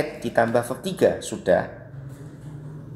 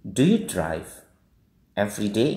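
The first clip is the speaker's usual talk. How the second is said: yes-no question rising on "day"; rising question intonation; 3 dB quieter than usual. Shown as a demonstration. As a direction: pause after "drive", then lift "every day". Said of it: "Ini naik, ya, intonasinya".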